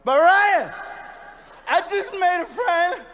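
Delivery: put-on high, loud slow drunken plea; drunk childish whine. Drunk comic pleading with his tour manager, high loud whine. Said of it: "I'm like".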